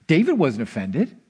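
ordinary talk, nothing marked

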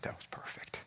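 hushed whisper of approval; a tender whispered reply. Retold or imitated. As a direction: breathe it softly to him. Pastor affirming man's prayer.

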